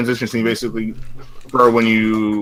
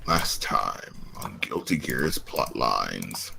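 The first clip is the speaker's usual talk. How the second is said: slow, soft recap narrator voice; dramatic "last time on" narration; overacted anime dub; 11 semitones lower, 12 dB quieter, slower than usual. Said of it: "imitate the dub voice for, like, the narrator in JoJo".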